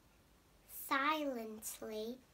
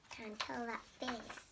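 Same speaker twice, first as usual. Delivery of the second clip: usual speaking voice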